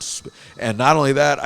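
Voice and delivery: usual speaking voice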